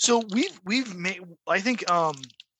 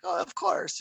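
higher-pitched mimicked colonel; French colonel; 7 semitones higher than usual. French colonel confirming he knows the cartoons, lifted quoted voice. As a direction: imitate the colonel readily agreeing. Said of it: "a French Special Forces colonel".

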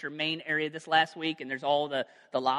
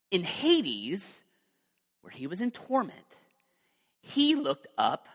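dramatic Bible reading, louder at the start; scripture reading; 4 dB louder than usual. Acted. Preacher reads Luke aloud to the congregation, dramatic reading. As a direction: read the passage with weight.

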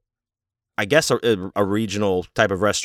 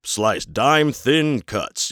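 slow, drawn-out instructional voice; hammed-up narrator, slowly; mock narrator; 3 semitones higher, much slower than usual. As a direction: lay it out very slowly like a training tape. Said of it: "the narrator's telling him".

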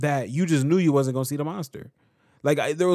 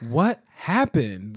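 ordinary talk

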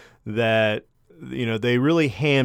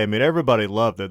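normal talking voice

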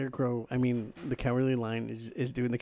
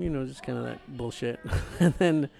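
ordinary talk, nothing marked

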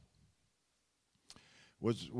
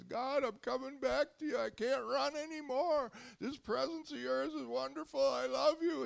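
much higher, pleading cry; crying out to God; 13 semitones higher than usual. Retold or imitated. Preacher voicing the waiter crying out to God, much higher. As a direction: plead upward like a man breaking.

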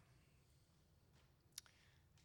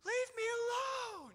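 put-on high, drawn-out childlike cry at moderate volume; mock tantrum wail; slower than usual. Preacher likening confused believers to his daughter, mock tantrum. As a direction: wail it slowly like a protesting child. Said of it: "that's how we felt".

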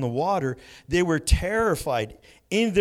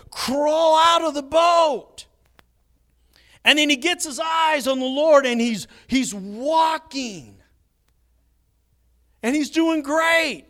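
high, loud, rising preaching; building excitement; 9 semitones higher, 5 dB louder than usual. Real, not acted. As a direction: build the story to a climax. Preacher builds Peter's walk.

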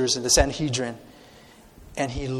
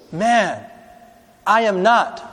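higher, louder indignant denial; heated denial; 6 semitones higher, 6 dB louder, much slower than usual. Acted. Peter denying to the accuser, heated and loud. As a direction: snap back in protest.

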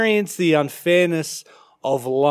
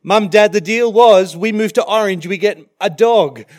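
put-on higher, louder childish pleading; mock kids begging for a dog; imitating the kids; 5 semitones higher, 7 dB louder than usual. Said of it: "our children have said".

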